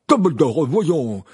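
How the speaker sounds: loud, high indignant outburst; angry exclamation. A narrator's reading. Fudge rebuking Dumbledore, angry. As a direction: burst out in protest. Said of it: "s'exclama Fudge avec colère".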